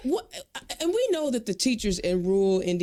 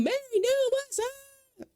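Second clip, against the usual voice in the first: high, sing-out co-host welcome; excited shout-out; slower than usual. Real, not acted. Host hails co-host on air, high excited shout-out. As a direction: hold it up high, drawn out in greeting.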